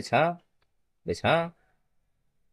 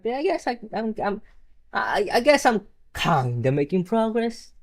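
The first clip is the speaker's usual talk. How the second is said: high grudging; 9 semitones higher than usual. Pretend.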